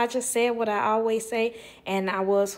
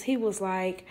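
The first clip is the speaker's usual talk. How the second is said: ordinary delivery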